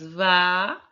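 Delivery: "vaca" with a long, loud "va", then a separate "ca"; slow syllable-by-syllable. Shown as a demonstration. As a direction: call out "vaca" one syllable at a time. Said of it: "vamos falar a palavra vaca pausadamente".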